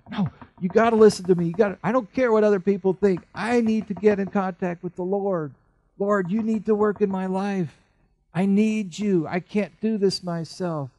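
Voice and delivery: high, loud, fast insistent pleading; desperate plea. Acted. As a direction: refuse to be silenced. Beggar's pleading voiced.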